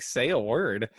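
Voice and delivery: ordinary delivery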